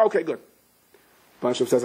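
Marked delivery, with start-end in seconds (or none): none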